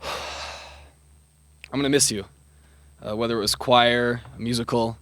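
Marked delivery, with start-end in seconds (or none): 0.0-2.2 slow, soft and choked up; emotional. Real, not acted. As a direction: let the feeling catch you, quietly. Graduate thanks a beloved teacher.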